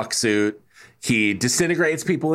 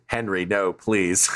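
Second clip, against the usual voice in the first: flat and lower, no emotion at all; deadpan; 6 semitones lower, slower than usual. Retold or imitated. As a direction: read it like a bored extra. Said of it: "Brackets, completely without emotion".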